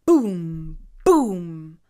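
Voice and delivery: deep, loud booming thuds; booming stomp sound. A narrator's reading. Narrator mimics the stomping beast, loud low booms. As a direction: thump it out like hooves.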